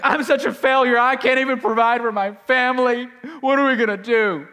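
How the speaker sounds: loud, high panicked wail; freaking out. Retold or imitated. Preacher re-enacting his money worries at home, freaking out. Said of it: "I'm freaking out".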